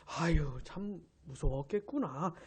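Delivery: low, soft, slow sympathy; gently soothe, as if commiserating with someone frightened; consoling. Acted. Jesus imagined comforting his scared disciples, consoling. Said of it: "이렇게 위로해 주시는 게 아니고".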